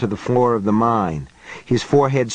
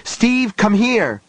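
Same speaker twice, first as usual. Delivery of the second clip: high, urgent shout, higher; 10 semitones higher, slower than usual. A narrator's reading. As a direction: yell for help while holding the boy. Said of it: "Johnny shouted".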